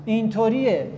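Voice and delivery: ordinary talk, nothing marked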